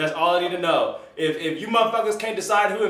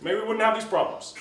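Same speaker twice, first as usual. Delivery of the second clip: Barack Obama; 7 semitones higher, 3 dB louder than usual. Acted. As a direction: do the former president, hesitating between phrases. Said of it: "I can do a little Barack".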